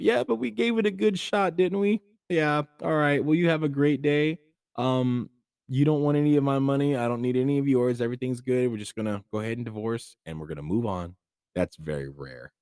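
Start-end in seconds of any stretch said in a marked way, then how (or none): 0.0-11.2 high, breezy voice of a friendly ex-spouse; amicable ex, put on. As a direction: act out a cheerful, easygoing breakup. Amicable divorce couple acted.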